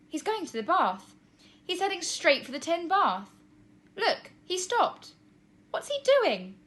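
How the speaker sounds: high, loud and eager; rising excitement as she watches; excited. A narrator's reading. Tina watching Charlie, excited. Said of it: "Her voice took on an excited edge".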